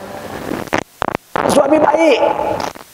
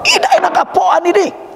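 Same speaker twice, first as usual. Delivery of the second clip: high, blunt spontaneous complaint; 12 semitones higher than usual. Retold or imitated. Husband blurting out at his wife's cooking, high blunt complaint. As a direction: blurt it out as a rude critic. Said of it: "Kalau kita spontan".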